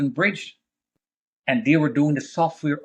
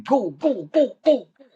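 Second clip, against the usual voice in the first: high mock cheering chant, a touch louder; crowd cheering; 9 semitones higher, 3 dB louder than usual. Crowd cheering the runners on, high excited chanting. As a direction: echo the crowd yelling go. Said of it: "the people are cheering".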